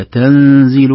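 تنزيل with a nasal hidden nun; loud تنزيل, nun blended into the next letter. Shown as a demonstration. Teacher repeating the right way, تنزيل with a hidden nun. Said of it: "صوت النون هذا".

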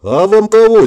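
high, loud gawping question; acted astonished greeting; jaw-dropped astonishment. A narrator's reading. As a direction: ask loudly, voice jumping up in amazement. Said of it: "еле удержал пытающуюся отвалиться челюсть".

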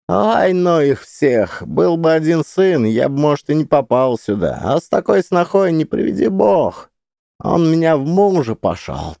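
high, loud complaining granny; old woman venting about family; grumbling old woman. Acted.

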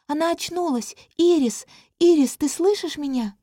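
high, eager calling; joyful princess calling; excited. A narrator's reading. Princess sees girl wake.

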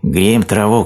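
storybook shout, pitched high; hare calling back. A narrator's reading. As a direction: call back as a little hare. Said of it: "крикнул заяц".

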